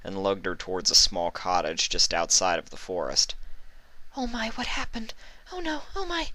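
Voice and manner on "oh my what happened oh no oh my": very high, soft fretful squeaks; nervous. A narrator's reading. Pegasus carrying Trixie home, nervous high fretting. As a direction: flutter with panic. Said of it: "said the mare nervously".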